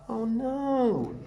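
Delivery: ordinary delivery, nothing marked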